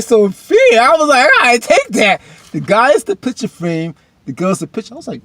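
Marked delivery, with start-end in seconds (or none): 0.0-2.2 loud, very high excited outburst; hyped reaction. Acted. Excited reaction to Sophia's comment. 2.5-4.9 higher, louder, relishing the line; hyped repeat. Retold or imitated.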